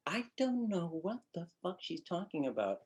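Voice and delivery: high singsong delivery, softly; over-melodic bad acting; melodic line reading. Shown as a demonstration. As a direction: sing the line out as a wrong melody. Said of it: "Wait, melodically, that's not, that's not right".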